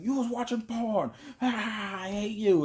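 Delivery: high-pitched imitation of a girlfriend's outburst; hysterical mock accusation.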